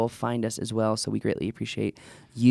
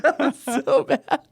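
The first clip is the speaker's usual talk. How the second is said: higher, laughing through the words; 13 semitones higher than usual. Real, not acted. Podcaster laughing at his own terrible accent, real laughter. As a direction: crack up at how bad it was.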